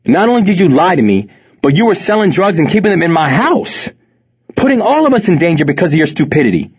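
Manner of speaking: high-pitched, forceful telling-off; angry scolding. A narrator's reading. Mother confronting her drug-dealing son, angry scolding. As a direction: lay down the law, outraged.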